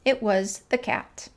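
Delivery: usual speaking voice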